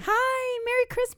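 high cheery voice. Acted. Cheery holiday greeting.

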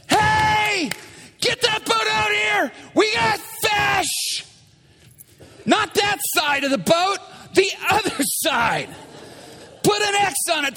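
high, urgent call to shore; excited holler. Acted. Peter calling his second boat out, excited. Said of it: "And Peter's like".

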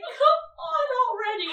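high, slow whiny complaint. Retold or imitated. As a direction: drag out the whine, scared. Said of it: "really whiny".

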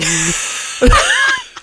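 high-pitched evil cackle; evil laugh. Real, not acted. Friend revels in turning savage, evil laugh. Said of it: "kind of an evil laugh".

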